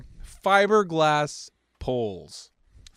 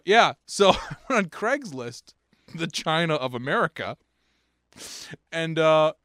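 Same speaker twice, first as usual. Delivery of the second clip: usual speaking voice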